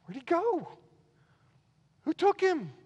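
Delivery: high, panicked questions; alarmed disbelief. Retold or imitated. Preacher acting out disciples finding the tomb empty, alarmed. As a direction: voice the shocked disciples.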